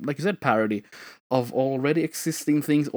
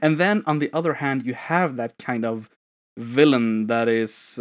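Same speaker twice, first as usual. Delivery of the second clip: ordinary talk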